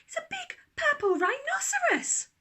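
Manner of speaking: put-on high, loud, desperate child's cry; shouted. A narrator's reading. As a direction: wail it out at full voice. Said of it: "Daisy shouted".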